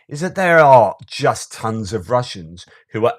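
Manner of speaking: ordinary delivery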